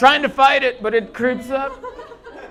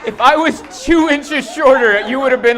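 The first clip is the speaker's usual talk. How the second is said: loud, indignant, punching two; exasperated shout; 7 semitones higher, 7 dB louder than usual. Real, not acted. Comic to fiancee, loud indignant shout. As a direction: shout it back in mock outrage.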